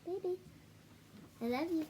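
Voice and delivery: ordinary delivery, nothing marked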